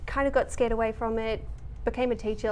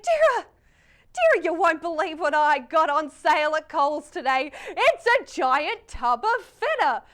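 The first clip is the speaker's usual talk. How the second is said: high, loud, thrilled mum; squealing with delight over a tub of feta; excited mum; 4 semitones higher, 5 dB louder than usual. Retold or imitated. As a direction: gush it like a mum with a bargain. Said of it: "she was like".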